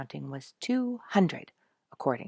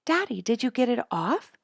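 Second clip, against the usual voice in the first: impatient; 9 semitones higher, 8 dB louder than usual. A narrator's reading. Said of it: "Sonya was impatient".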